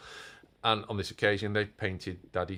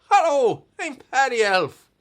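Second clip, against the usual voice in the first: much higher, loud, laughing Irish elf voice; cad Irish accent; 13 dB louder than usual. Pretend. Dad voicing Paddy the puppet elf, hammy Irish accent. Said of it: "with a cad Irish accent".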